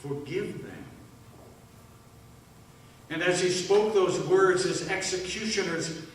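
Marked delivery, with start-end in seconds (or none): 0.0-0.9 "Forgive them" repeated much softer, in wonder, FORGIVE falling; awed echo. Acted. Thief repeating the words to the crowd, in hushed wonder. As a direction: hush it, as if hardly believing it. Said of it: "Can you believe that?".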